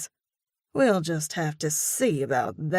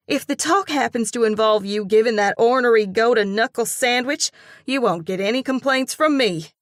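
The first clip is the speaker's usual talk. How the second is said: fast, loud fired-up friend; fiery; 6 semitones higher, 7 dB louder, faster than usual. A narrator's reading. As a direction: egg her on with fire. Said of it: "the fire under Ellie's belt".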